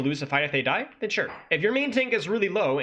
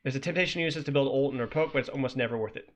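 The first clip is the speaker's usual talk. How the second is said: ordinary talk, nothing marked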